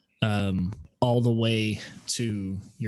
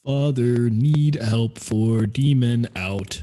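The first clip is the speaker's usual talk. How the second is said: slow halting tree voice; slower than usual. Acted. Tree repeats message slowly.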